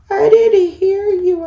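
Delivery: high, loud mock-pleading apology; playful apology. Real, not acted. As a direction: plead your innocence in a high voice. Apologising to viewers who yelled.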